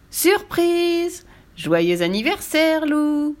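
high, drawn-out birthday cheer; surprise shout. A narrator's reading. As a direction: call it out like friends jumping out. Friends jump out shouting surprise.

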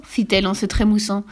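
usual speaking voice

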